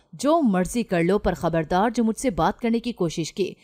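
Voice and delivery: ordinary delivery, nothing marked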